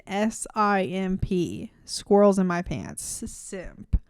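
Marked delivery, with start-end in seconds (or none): 3.2-4.0 hushed tag word; whispered punchline. Real, not acted. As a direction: drop to a whisper on simp.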